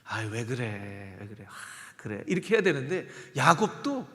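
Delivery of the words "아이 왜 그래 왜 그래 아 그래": calm a wife down gently; husband softly calming his upset wife; soothing husband. Acted. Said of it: "보통 남편이 어떻게 해야".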